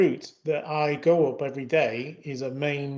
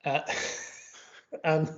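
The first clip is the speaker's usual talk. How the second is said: ordinary delivery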